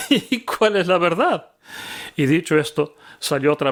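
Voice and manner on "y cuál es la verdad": scoffing laugh. Acted. Pilate scoffs at Jesus.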